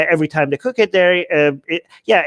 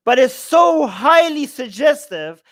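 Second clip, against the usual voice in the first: so stretched out, higher and slow; emphatic shout; 6 semitones higher, slower than usual. Real, not acted. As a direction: lean hard on so highly. Stressing the argument.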